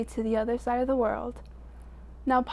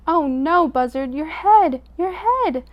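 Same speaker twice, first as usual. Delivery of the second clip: loud, high alarmed cry; alarmed shout; 5 semitones higher, 8 dB louder than usual. A narrator's reading. Animals warning Buzzard his head burns, loud alarmed cry. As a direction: yell the warning.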